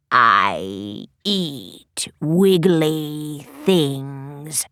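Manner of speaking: very low, very slow scratchy hiss; scratchy monster whisper. Acted. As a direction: whisper like a hideous hungry beast. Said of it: "hideous, scratchy voice whispered".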